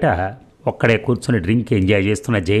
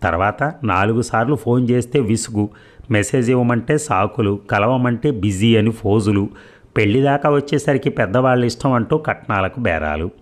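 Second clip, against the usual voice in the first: ordinary delivery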